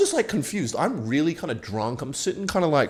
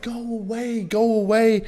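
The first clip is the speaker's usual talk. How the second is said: higher girl imitation pushing him away; mock girl voice; 11 semitones higher, slower than usual. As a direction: shoo him off in her voice.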